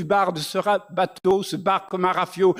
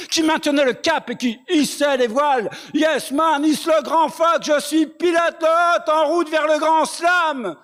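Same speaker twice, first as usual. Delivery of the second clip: high-pitched rallying call; rousing slam shout; 9 semitones higher than usual. Acted. Poet as pilot setting sail for the grand slam, high rousing shout. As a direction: build to a shouted peak, drawing out the end. Poem's crew hoists the sails.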